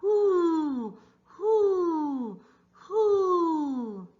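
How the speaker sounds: high hooting owl call; long high hoo hoos. Acted. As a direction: hoot like an owl for the kids. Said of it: "Owl says".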